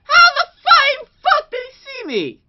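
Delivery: high, loud, cracking scream; raging scream. Real, not acted. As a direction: scream it at the screen. Peak of gaming rage.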